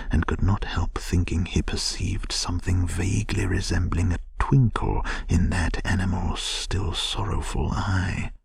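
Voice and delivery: plain reading voice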